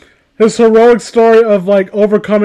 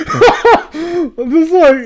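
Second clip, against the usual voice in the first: laughing hard; 10 semitones higher, much slower than usual. Real, not acted. Laughing fit at the story.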